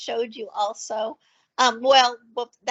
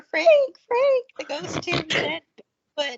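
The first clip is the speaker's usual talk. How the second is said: calling out; 7 semitones higher, 3 dB louder than usual. Retold or imitated. Calling out to neighbour, alarmed.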